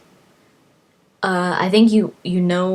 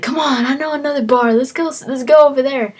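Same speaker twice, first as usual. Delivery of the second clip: higher and fast, pushing to go to another bar; a drunk pal hurrying you along; eager drinking buddy; 7 semitones higher, 4 dB louder than usual. Retold or imitated. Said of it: "and then he's like".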